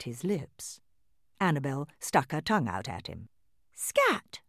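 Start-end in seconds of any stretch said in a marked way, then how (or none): 3.7-4.4 lightly voiced tiny doll, high. A narrator's reading. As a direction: shoo the cat in a tiny doll voice. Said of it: "in her tiny doll voice".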